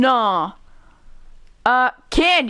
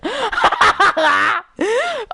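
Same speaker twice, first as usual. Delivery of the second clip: high burst of laughter; real cackling laugh, rising high; 9 semitones higher than usual. Real, not acted. Laughing at awkward game scene.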